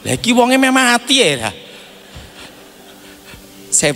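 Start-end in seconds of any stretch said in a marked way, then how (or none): none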